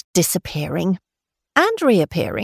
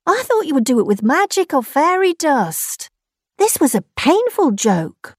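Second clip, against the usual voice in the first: hurt complaint; 7 semitones higher than usual. Acted. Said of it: "replied Kangaroo".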